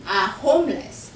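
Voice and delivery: ordinary talk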